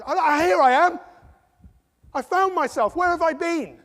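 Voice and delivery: high, loud cry of self-discovery; mock elated discovery. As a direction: exclaim it as if suddenly finding yourself.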